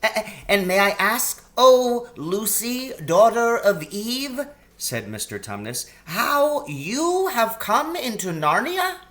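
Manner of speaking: a polite, flustered faun covering a slip; high eager faun babbling; excitable faun. Acted. Faun character voice.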